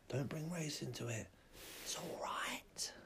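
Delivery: unvoiced, slow whisper; whispered mock reassurance. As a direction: hush it like a secret. Hushed soothing aside.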